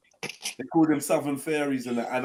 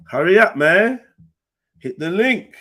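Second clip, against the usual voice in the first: louder, pushy hurry up; impatient call; 4 semitones higher, 9 dB louder than usual. Real, not acted. Host calling the audience to hurry, impatient. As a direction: hurry them along, raised voice.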